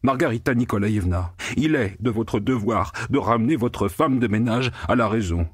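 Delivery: fast, high, indignant protest; a bureaucrat scandalised; the pig lecturing Margarita fussily; pompous pig-man. A narrator's reading. The pig's pompous complaint.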